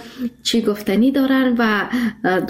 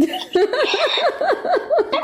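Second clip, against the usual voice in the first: bright, high-pitched happy laugh; delighted high laugh. Real, not acted. Host laughs at praise.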